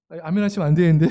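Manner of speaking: ordinary talk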